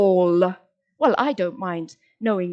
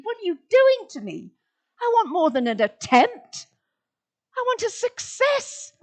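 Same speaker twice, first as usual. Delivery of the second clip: high, pleading complaint; mock protest; 10 semitones higher than usual. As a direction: whine up to the author in protest. Mock complaint to Shoghi Effendi.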